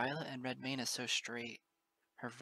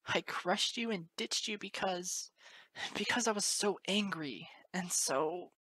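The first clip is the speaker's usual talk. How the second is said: higher, voice trembling with guilt; shaking voice; 4 semitones higher than usual. Acted. Moon Dancer confessing to Lyra, shaking voice. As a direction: let the voice shake. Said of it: "her voice starting to shake".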